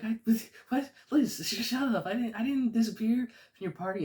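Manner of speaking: very high, loud panicky squeal; frantic denial in a put-on voice; panicked Peter. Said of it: "He's like".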